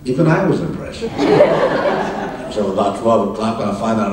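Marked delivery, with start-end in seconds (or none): 1.1-2.5 high unvoiced laugh. Real, not acted. Laughing after saying even he was impressed. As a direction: laugh at himself.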